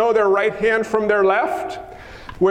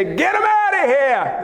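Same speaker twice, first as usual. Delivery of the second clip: brusque disciples' shout; 5 semitones higher than usual. Acted. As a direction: shoo them off in a raised voice. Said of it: "That's exactly what it sounded like".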